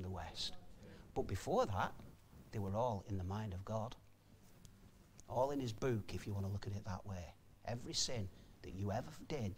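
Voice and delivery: low, soft, reflective sermon voice; hushed preaching. Real, not acted. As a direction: drop right down and let it weigh on them.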